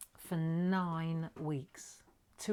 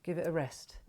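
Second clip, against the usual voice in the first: usual speaking voice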